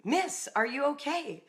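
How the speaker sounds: high shouted concern; calling out. Retold or imitated. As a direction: call out to her from the fire truck. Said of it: "start yelling".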